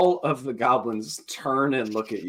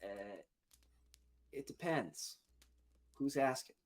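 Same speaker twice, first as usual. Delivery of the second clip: wary goblin; 3 semitones higher, 15 dB quieter than usual. Acted. Wary goblin questions the bard.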